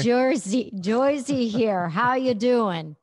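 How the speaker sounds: loud, hammed-up Jersey accent, slow and drawn out. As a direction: belt it out like someone from Jersey. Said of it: "gotta say it with an accent".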